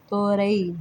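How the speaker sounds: तोरई said as to-ra-ee; तोरई with a separate long ee after ra; other people's variant. Retold or imitated. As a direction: say तोरई in three syllables, ending ra-ee. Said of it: "लोगों के मुंह से मैंने सुना है".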